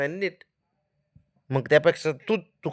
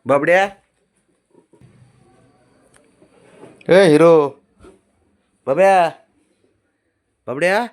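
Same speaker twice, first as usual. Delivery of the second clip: loud calls to the dog; calling out; 4 semitones higher, 9 dB louder than usual. Real, not acted. Owner calling the dog off her spot, loud calls. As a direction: call him off the bed, loud and high.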